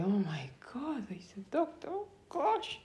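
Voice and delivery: half-whispered amazement rising high at the end; retold breathless swoon; hushed awe. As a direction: gasp it softly to yourself, lifting into a high "oh gosh". Retold awed reaction to the doctor.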